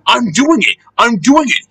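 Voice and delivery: high, straining storybook engine; panting. A narrator's reading. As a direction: pant it out in short excited phrases. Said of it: "he panted".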